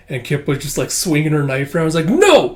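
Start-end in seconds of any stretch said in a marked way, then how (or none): 2.1-2.6 high, loud outburst of refusal; defiant shout. Acted. Kippa refuses, swinging her knife.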